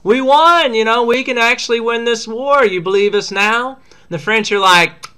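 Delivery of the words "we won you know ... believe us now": a high, eager, lightly voiced diplomat; Ben Franklin. Franklin telling the French we won, high and eager. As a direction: boast to the French with a grin in the voice. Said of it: "Ben Franklin shows up and he's like".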